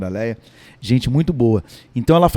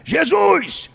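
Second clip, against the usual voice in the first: shrill accusing cry, far higher; yelling across the room; slower than usual. Shown as a demonstration. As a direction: shout it from the back of the crowd. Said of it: "o fariseu gritou lá de trás".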